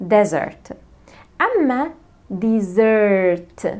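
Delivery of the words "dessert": "dessert" with a long stressed SERT; second-syllable stress. Shown as a demonstration. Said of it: "اسمعوني كويس". (النبر على المقطع التاني وممدود في "dessert")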